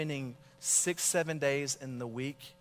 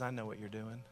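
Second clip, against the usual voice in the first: softer, low, knowing; quiet knowing line; 5 semitones lower, 9 dB quieter than usual. Retold or imitated. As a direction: say it low, like you see through him. Drunk stranger's first words.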